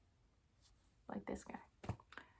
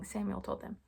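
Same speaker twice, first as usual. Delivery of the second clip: ordinary delivery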